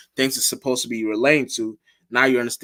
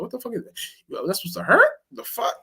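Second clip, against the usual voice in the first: mocking disbelief; 11 semitones higher than usual. Mocking a thrown rock.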